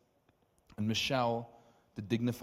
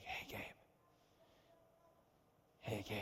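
soft call; 12 dB quieter than usual. Retold or imitated. Jesus calling softly.

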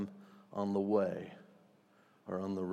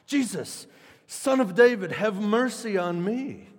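high, loud cry for mercy; a beggar shouting for mercy from the roadside; desperate shout; 9 semitones higher, 7 dB louder than usual. Acted. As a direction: call out to Jesus over the crowd. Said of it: "he began to shout".

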